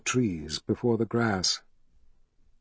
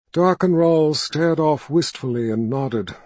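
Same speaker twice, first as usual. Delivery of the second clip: ordinary delivery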